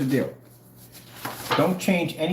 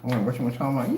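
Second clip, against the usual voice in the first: ordinary talk, nothing marked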